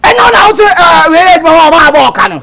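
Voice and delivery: high, fast proclamation. Shown as a demonstration. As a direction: pitch it up and rattle the line out.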